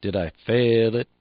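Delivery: rhythmic "Did I fail it?" stretched on "fail"; long FAIL. Shown as a demonstration. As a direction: hold "fail" long, keep "Did I" and "it" short. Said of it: "Be sure you get the proper inflection".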